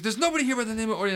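quick, brushing-off phone answer; wife about to hang up. Retold or imitated. Wife hanging up on a supposed scammer, curt and quick. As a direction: rattle it off to get rid of him. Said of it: "She's like".

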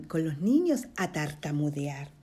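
ordinary delivery, nothing marked

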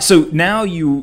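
normal talking voice

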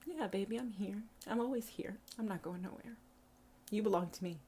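soft, intimate divine voice; deity's tender reassurance. Acted. Deity telling a hurting child she is always here, tender. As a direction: soothe quietly as a loving deity. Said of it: "she says".

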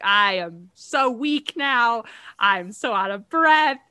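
헉헉대는 척 과장해서 불평하며; mock exhausted complaint. Retold struggling first postpartum run.

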